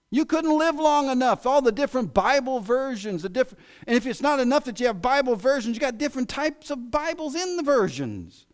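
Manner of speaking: emphatic preaching. Acted. Preacher marvels at all the Bible versions, loud and high. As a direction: hammer it home.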